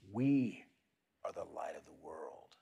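whisper. Shown as a demonstration. Pastor whispers the affirmation for listeners to echo. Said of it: "whisper this to yourself".

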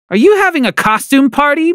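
high, sharply annoyed question. Acted. Mom on video call annoyed at the costume, irritated. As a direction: ask it with irritated disbelief. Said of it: "clearly annoyed".

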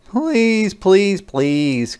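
begging, first please high; children pleading with dad; pleading kids. Acted. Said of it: "cried Junie and Jakey".

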